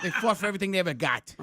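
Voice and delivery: put-on fast comic Boston accent; rapid put-on Boston accent. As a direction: rush it out in Boston-speak. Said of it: "that's Boston".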